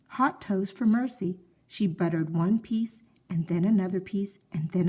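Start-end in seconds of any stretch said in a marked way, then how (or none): none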